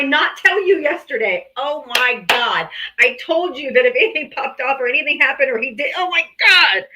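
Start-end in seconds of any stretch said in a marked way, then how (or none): none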